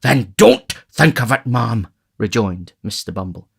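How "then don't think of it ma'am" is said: loud, higher, firm reply; emphatic rejoinder. A narrator's reading. Beadle rejoins to the fretting matron, loud and emphatic. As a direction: cut off her fretting firmly and loudly.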